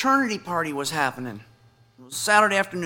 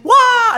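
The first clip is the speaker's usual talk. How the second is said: mock wail; 8 dB louder than usual. Told the brace stops breathing.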